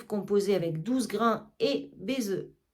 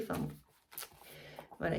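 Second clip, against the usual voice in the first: ordinary talk, nothing marked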